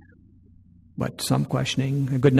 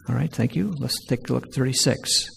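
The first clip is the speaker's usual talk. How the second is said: ordinary delivery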